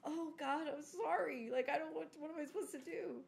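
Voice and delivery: high-pitched mock pleading; helpless apology. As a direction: plead helplessly, as a flustered parent. Retold apology to upset kids.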